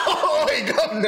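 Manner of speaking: joining in with a mock British accent; hammed-up British accent through laughter; silly cartoon British call; Stewie from Family Guy. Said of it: "speaks like Stewie from Family Guy".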